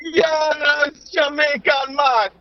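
hammed-up, slow Jamaican accent; joke Jamaican accent. Guest playing along as Jamaican Matt, mock Jamaican. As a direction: greet everyone in a broad mock-Jamaican accent. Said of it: "are you also Jamaican now".

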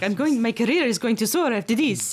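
a bit of a bad Italian accent; mock Italian accent. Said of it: "He's like".

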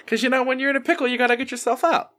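squeaky fast cartoon voice; high silly voice. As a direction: pipe it up high and quick. Sudden silly character voice line.

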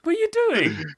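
very high incredulous mock yell; yelling at screen. Baffled player to the game, high mock yelling. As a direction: squeal it in mock outrage at the game. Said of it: "me yelling at the screen".

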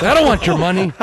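loud, high, angry refusal. Retold or imitated. Ticket holder angrily refusing the money. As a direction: throw it back at him, loud.

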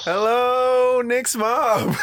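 high, loud, cheery hello; excited greeting. Real, not acted. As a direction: greet her brightly. Greeting friend's mother.

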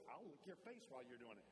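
rising, giddy pleading as the dog; eager dog. Retold or imitated. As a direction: talk like an overjoyed puppy. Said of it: "The dog will do it".

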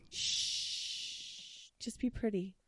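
hushed teasing whisper. Pretend. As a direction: whisper it softly. Hushing the other host jokingly.

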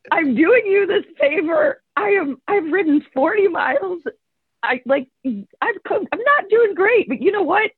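high-pitched, whiny retold protest; exasperated protest. Exhausted rider protests at teasing remark.

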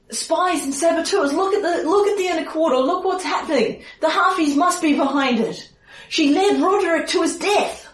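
high-pitched, panicky accusation; frantic accusing townsman. Acted. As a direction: insist on treachery, voice pitched right up. Game master voices accusing Basil.

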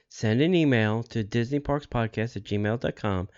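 ordinary talk, nothing marked

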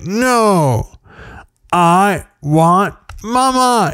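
put-on high, stretched-out child's wail at normal volume; mock screamed refusal. Dad imitating his daughter refusing to stop, mock screaming. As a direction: imitate a kid refusing, each word held long and high. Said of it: "Coco, stop screaming".

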